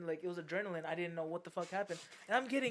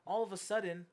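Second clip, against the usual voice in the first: ordinary talk, nothing marked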